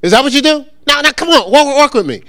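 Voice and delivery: ordinary delivery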